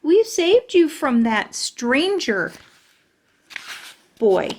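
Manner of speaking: slow, proud declaration; proud exclamation. Acted. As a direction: announce the rescue proudly. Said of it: "I exclaimed, very proud".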